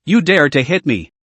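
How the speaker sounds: ordinary delivery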